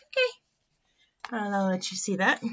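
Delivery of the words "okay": small, high otter voice; high otter reply; Miss Otter. A narrator's reading. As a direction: answer in a tiny high-pitched voice. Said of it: "She's like".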